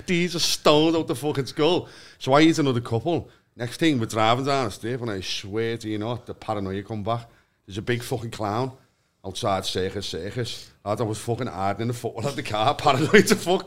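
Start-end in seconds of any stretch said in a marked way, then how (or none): none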